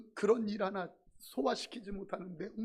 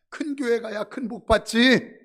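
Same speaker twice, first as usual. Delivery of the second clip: mock boast; 13 dB louder than usual. Pastor mimics a neighbour woman's loud boasting. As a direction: belt it out like a smug saleswoman.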